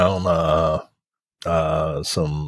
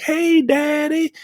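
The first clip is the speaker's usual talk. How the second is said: put-on much higher, slow little-girl voice; mock sweet daughter; slower than usual. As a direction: coo it like a daughter at the bedside. Voicing daughter in retold scene.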